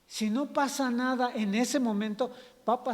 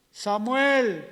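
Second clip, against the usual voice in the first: loud, stretched name call; acted call from afar; 5 dB louder than usual. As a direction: summon a sleeper by name. God calls Samuel once more.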